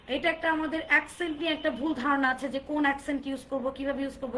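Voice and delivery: ordinary talk, nothing marked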